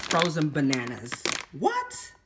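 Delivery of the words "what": very high, rising squeak of disbelief; incredulous. Acted. Shopper amazed at two-dollar fruit, incredulous. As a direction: shoot right up in pitch, astonished.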